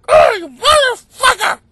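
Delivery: high, loud mock screech; hammed-up angry wife. Wife cursing her sleeping husband in the basement, mock screeching. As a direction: screech a cartoonish insult. Acts out wife attacking him asleep.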